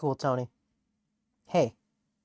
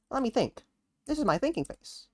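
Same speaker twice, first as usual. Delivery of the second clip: Tony voice; 8 semitones higher, 4 dB quieter than usual. Acted.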